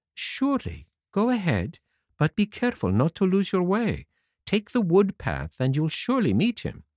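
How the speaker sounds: a hint of a kind fairy sister; gentle fairy voice. A narrator's reading. The fairy answers Pinocchio in a lightly voiced fairy tone.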